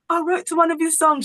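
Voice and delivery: excited whisper-shout. Retold or imitated. Telling the drummer she wrote to his song, high and giddy. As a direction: spill it out, thrilled and giddy. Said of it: "And I was like".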